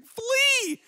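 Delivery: high-pitched comic scream; mock yell. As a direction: yell it as a panicked mom, hammed-up. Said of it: "she yelled at him and was like".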